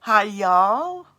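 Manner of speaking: loud southern twang on hi y'all; second go at a Southern drawl; American accent try. Shown as a demonstration. Host repeats her American accent attempt, drawled. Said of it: "Does that sound American?".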